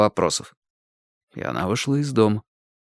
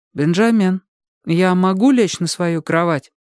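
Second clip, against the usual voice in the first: higher, pleading to be let sleep; insistent sister voice; 7 semitones higher than usual. Acted. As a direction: sister asking for her bed. Therese wakes narrator, repeats.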